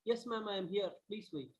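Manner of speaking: faint voice, almost lost; hardly heard, far too soft; barely audible. Real, not acted. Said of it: "Your voice is very low".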